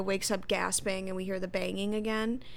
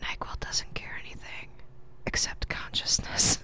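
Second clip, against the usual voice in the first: whisper. Acted. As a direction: whisper it, stretching the final words. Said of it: "this is all in whisper".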